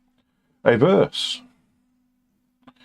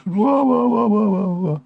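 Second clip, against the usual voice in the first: much higher fake baby crying; mock crybaby wailing; 11 semitones higher than usual. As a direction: bawl like a mocked crybaby. Mocking crybabies.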